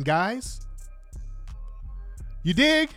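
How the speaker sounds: ordinary delivery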